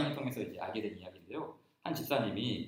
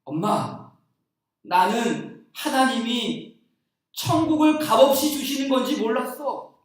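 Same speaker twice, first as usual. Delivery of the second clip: put-on child's tearful voice; 8 semitones higher, 9 dB louder, slower than usual. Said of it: "그러면서 엉엉 울었다는 거예요".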